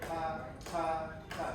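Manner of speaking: short separated "Ta, ta, ta" with gaps; detached rhythm syllables. Shown as a demonstration. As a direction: clip each "ta" and leave space. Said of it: "put a little space there so we hear it".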